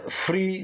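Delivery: free said high and drawn out, like an ad shouting it; stress on free. Shown as a demonstration. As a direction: hit free hard and high like a sales sign. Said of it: "emphasis on the free".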